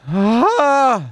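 high, loud, sustained scream. Real, not acted. Streamer finally allowed to scream, loud release. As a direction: let out the long pent-up scream. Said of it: "Du får skrika".

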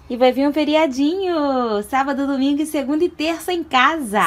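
big happy announcement; loud announcement. Real, not acted. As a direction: announce it loudly, thrilled. Announcing holiday to child.

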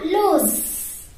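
loose ending in a buzzing z, luz; z sound. Shown as a demonstration. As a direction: say loose with a voiced z at the end. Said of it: "Z sound is Z".

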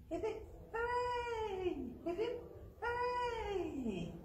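high, bright cheer for the class. Real, not acted. Teacher cheering the children after the birthday song. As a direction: throw the hooray up high, cheering.

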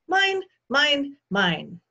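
put-on high, loud little-kid voice; possessive toddler. A narrator's reading. Toddler clutches the book, loudly possessive. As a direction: claim it like a stubborn small child.

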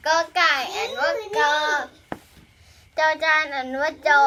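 loud recitation. Shown as a demonstration. Teacher restarts the letter drill for the young pupils, loud recitation.

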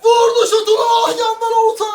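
play-by-play. Acted. Live play-by-play of own play.

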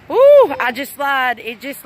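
sudden gasp of surprise at lightning; startled by lightning. Real, not acted. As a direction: caught off guard by a flash. Lightning strikes mid-sentence.

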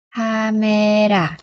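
CAMERA stretched very slowly, syllable by syllable; drawn out. Shown as a demonstration. Teacher modelling a Korean word for learners, drawn out. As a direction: say "Camera" very slowly, holding the vowels.

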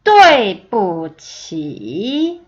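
对不起 very slow, sharp fall then a dip and rise; tone demonstration. Shown as a demonstration. Teacher modelling the whole phrase, 对不起 slow and toned. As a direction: hit 对, drop, then stretch 起 through its dip. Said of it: "한 번 더 들어보세요".

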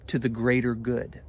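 usual speaking voice

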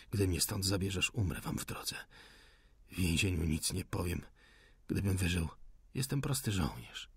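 soft, subdued defiance; weak wounded insurgent. A narrator's reading. Wounded insurgent refuses to talk in prison, softly. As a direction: refuse quietly, low on strength.

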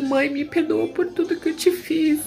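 high, tearful, begging voice; a voice close to breaking, begging forgiveness; tearful plea to mother. Retold or imitated. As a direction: plead with her dying mother through tears. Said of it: "eu comecei a chorar desesperada".

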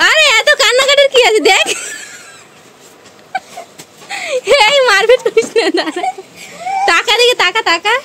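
half shouting, half laughing at her; loud scolding. Real, not acted. Scolding crying little sister. (বকাঝকা করা চড়া গলা)

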